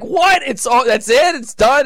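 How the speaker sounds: high, loud, incredulous cry; stunned disbelief. Retold or imitated. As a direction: sound like the rug just got pulled out. Fan stunned by disallowed goal.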